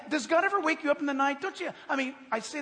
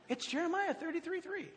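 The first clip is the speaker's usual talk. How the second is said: put-on little kid; 6 semitones higher, 8 dB quieter than usual. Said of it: "what the little kid said".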